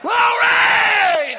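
full-voiced preacher's cry, high and loud; ringing shout of glory; shouted praise. Real, not acted. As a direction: burst out with glory.

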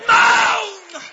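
one long, high, wailing shout; preacher's shout. Real, not acted. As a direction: bellow the word and let it fall. Preacher cries out the key word.

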